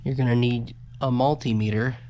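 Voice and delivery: ordinary talk, nothing marked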